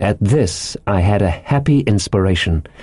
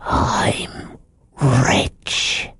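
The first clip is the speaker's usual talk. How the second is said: hushed, secretive whisper; 3 semitones higher, 4 dB quieter than usual. A narrator's reading. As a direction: whisper it like a great secret, pausing midway. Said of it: "lowering his voice to a whisper".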